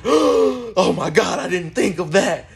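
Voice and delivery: high, slow, drawn-out gasping surprise; stunned realization. Retold or imitated. Boy seeing his friend grab two icicles, high stunned surprise. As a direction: sound floored by the trick.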